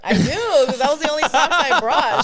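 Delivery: high and loud, laughing. Real, not acted. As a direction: laugh as you insist. Laughing about lost socks.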